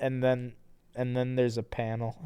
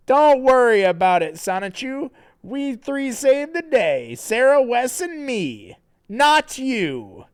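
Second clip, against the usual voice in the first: character voice; 12 semitones higher, 9 dB louder than usual. Acted. Reading Sonic's lines in voice.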